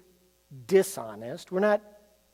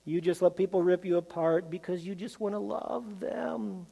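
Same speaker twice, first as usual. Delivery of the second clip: normal talking voice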